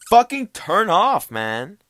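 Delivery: loud, high exasperated yell; drawn-out, high annoyed shout; yelling a little. Real, not acted. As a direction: snap at a phone that won't stay off. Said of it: "whenever I yell a little bit".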